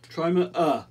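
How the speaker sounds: mock bellow from a storybook bull. A narrator's reading. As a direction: push it out as a bull's bellow. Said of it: "bellowed the bull".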